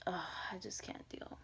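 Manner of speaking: whispered, worn-out sigh of a line. Real, not acted. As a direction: let it fall to a tired whisper. Exhausted aside.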